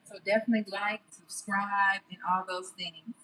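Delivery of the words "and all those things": ordinary talk, nothing marked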